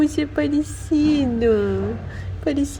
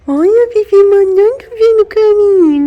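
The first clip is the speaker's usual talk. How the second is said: higher, louder delighted exclaim; excited; 8 semitones higher, 10 dB louder than usual. Real, not acted. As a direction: burst out delighted. Excited about kitten. (exclamação animada, mais aguda e forte)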